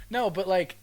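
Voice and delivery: ordinary delivery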